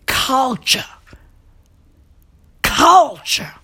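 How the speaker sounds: breathy, stagey whisper of the word; drawn-out breathy incredulity; hushed disbelief. Acted. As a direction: whisper it as if dumbfounded. Incredulous repeat of baby's name.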